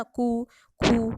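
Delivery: कु, खु said very slowly but kept short. Shown as a demonstration. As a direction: clip the u in कु and खु. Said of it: "खूप उ, मोठा उ असं नाही करत आपण". (ऱ्हस्व उ)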